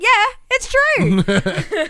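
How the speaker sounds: high, loud, slow drawn-out confirmation; drawled mock Renee. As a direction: stretch it out smugly. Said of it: "And she's like".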